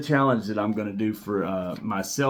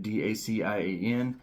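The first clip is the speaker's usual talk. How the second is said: usual speaking voice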